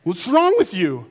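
put-on high, loud child's correction; mock outrage in a high voice; indignant young niece. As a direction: imitate a little kid scolding a clueless grown-up. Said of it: "And she'd go".

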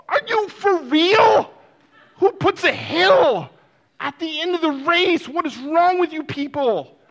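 high, loud indignant outburst; outraged yelling. Acted. Exhausted runner berating workers about the hill, loud indignation.

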